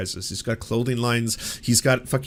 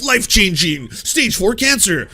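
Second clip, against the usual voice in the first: higher, louder, slowed mock drama; sarcastic tearjerker emphasis; mock dramatic caption; 6 semitones higher, 6 dB louder, slower than usual. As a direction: read it like a sappy pop-up caption.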